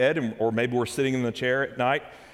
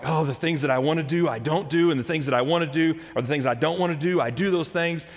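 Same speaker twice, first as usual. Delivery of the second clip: higher, louder exasperated venting; 5 semitones higher, 4 dB louder than usual. Acted. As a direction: vent like a man fed up with himself. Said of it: "he's exasperated".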